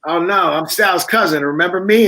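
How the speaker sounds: mock innocent plea to the bartender, rising at the end. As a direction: act like she surely knows you. Pleading with the bartender.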